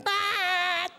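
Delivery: mock car horn "tat"; a quick imitated honk; horn beep. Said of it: "Dia pencet".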